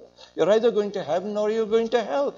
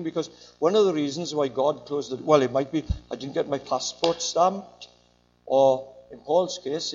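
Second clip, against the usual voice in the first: normal talking voice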